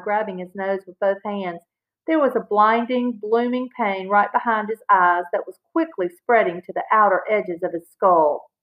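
usual speaking voice

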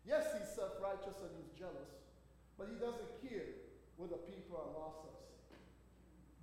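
normal talking voice

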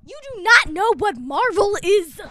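loud, emphatic mock disbelief; mock shock. Interviewer accusing host, mock-shocked. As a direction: scold in feigned astonishment. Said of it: "be shocked at Jordan for not knowing".

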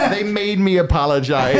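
high-pitched retelling through real laughter; amused, laughing mock protest. Real, not acted. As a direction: repeat the punchline while cracking up. Repeats punchline amid laughter.

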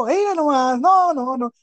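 high, hammed-up norteña moaning; playful norteña accent imitation, high-pitched; Monterrey woman's accent. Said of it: "allá en el norte".

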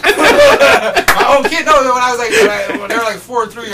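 high, loud words tumbling through laughter; laughing while talking. Real, not acted. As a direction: crack up while telling it. Laughing while telling funny story.

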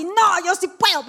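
"No, yo sí puedo" pushed out slow and high, half crying; tearful self-belief, slowly; forceful affirmation. Shown as a demonstration. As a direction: declare it with tearful conviction. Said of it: "pero así fuerte".

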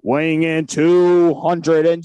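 slow, drawn-out fight-night introduction; ring announcer. Pretend. Mock boxing ring introduction.

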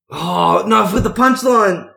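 high, loud cry of real dismay; dismayed outburst. Real, not acted. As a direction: blurt it out as the joke slips away. Realises the punchline is forgotten.

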